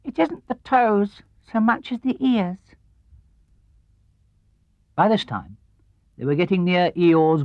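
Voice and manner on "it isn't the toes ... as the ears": much higher, slow and solemn squeak; a tiny piglet being very grave. Acted. Piglet solemnly corrects Pooh, high and slow.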